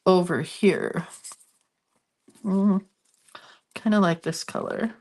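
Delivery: stuffy nose from allergies; congested. Real, not acted. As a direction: talk through a congested nose. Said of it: "I'm so sorry for the sniffling".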